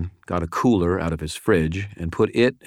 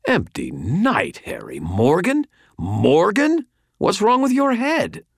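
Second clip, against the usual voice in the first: incredulous; 14 semitones higher, 3 dB louder, slower than usual. A narrator's reading. Thomas incredulous at helping Morgan.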